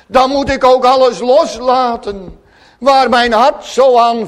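loud, impassioned pulpit voice; fervent preaching. Acted. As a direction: preach it out with full conviction. Preacher in full flow. (vurig preken, luid en hartstochtelijk)

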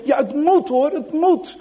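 high, loud sharp insistence; snapping. Acted. Piet snapping at crying Johan, loud and sharp. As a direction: snap it, no arguing. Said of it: "begint te snauwen en te grommen".

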